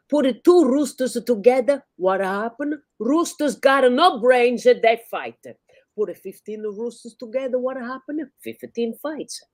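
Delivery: high, loud Italian wife. Acted. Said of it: "like a loud Italian".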